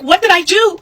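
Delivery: high-pitched, baffled retelling; confused protest. Retold or imitated. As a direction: plead bewilderment, pitched well up. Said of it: "I was so confused".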